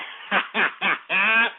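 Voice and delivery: laughing at his own boast. Real, not acted. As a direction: burst out laughing after boasting.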